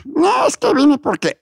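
high, slow puppet voice; squeezed-up little puppet character; Pepo. Acted. Puppet explaining to the host why it came, high puppet voice. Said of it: "entrar con el monito".

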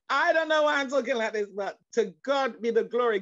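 usual speaking voice

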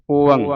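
ਓੰਗ drawn as "o-ang" with an open o; open o, nasal ending. Shown as a demonstration. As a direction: sound a full o then a nasal ang in ਓੰਗ. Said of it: "ਊੜੇ ਦਾ ਮੂੰਹ ਖੁੱਲ੍ਹਾ".

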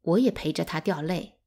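ordinary delivery, nothing marked

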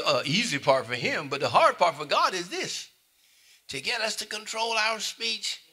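high, loud, rising preacher's build; impassioned preaching. Acted. Preacher pressing the church on free will, high and loud. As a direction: drive the point home to the saints.

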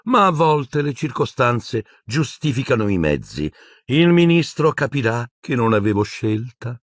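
high-pitched fretful villainess; uneasy self-justifying. A narrator's reading. Umbridge excusing her methods, uneasy and high. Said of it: "continuò a borbottare".

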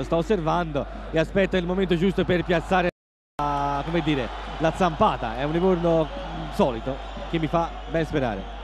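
usual speaking voice

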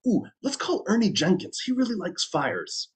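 put-on high-pitched, eager boy; boy's voice. A narrator's reading. Jim to his friend at the telephone box, high boy's voice. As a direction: pipe up high like a boy with an idea. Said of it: "Jim said".

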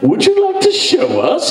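high British host invitation; British accent. Retold or imitated. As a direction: ask it like a British luvvie. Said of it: "without the British accents".

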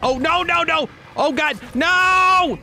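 very high, loud rapid cries of no; high, loud frantic repeated no; frantic panic. Real, not acted. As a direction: panic as everything goes wrong. Trapped, frantic button panic.

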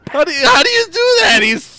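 very high, fast, loud squeal; excited squeal. Real, not acted. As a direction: squeal it out in disbelief. Amazed at friend's trick.

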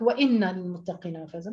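ordinary talk

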